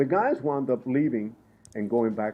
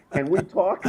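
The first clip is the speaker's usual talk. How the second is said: higher, louder laughing catchphrase; Joan Rivers impression; 8 semitones higher, 7 dB louder than usual. Guest joking to the host, in a laughing, higher Joan Rivers voice. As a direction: toss it off like a gossipy comedienne, laughing. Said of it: "I feel like Joan Rivers".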